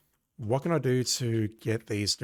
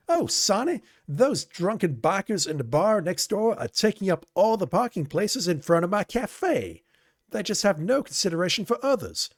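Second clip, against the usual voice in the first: high-pitched, agitated café owner pleading; upset loud blurt; 8 semitones higher than usual. A narrator's reading. Café owner Carol begging Sonny about the bikers, high upset blurt. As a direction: blurt it out, upset and a bit louder. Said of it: "she blurts in a loud tone of voice".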